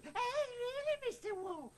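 mock pig. [name] mockingly voicing the pig to wreck the show, squeaky.

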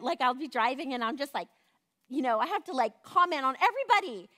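usual speaking voice

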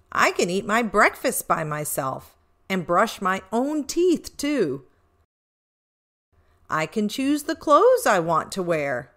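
ordinary delivery, nothing marked

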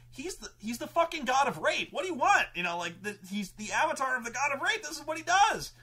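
high, loud retold protest; exasperated defense. Game master justifying his villain to players, exasperated protest. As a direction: argue it high and insistent.

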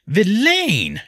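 high, loud stern correction; stern interjection. A narrator's reading. Serf woman correcting the nobleman, stern and loud. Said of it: "she interjected sternly".

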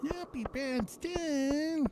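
slow, high cartoon kid voice; Cartman voice. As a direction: drag it out like Cartman. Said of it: "in the Cartman voice".

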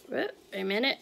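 ordinary delivery

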